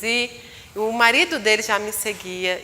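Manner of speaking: ordinary talk, nothing marked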